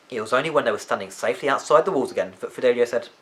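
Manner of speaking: ordinary delivery, nothing marked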